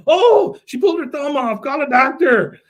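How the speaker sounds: hammed-up panic over a thumb; mock panic. Jimmy freaking out at the thumb trick, shrill mock panic. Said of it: "he says".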